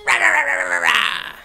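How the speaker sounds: high, loud roaring rah rahs like a cartoon dragon; mock dragon roar. Reviewer mocking the dragon's roar, loud cartoon roar. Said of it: "the dragon goes".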